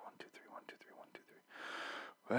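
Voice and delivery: whispered counting loop; imitated looping broadcast. Imitating the broadcast repeating itself over and over, whispered.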